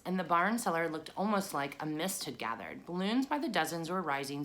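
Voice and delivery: ordinary delivery, nothing marked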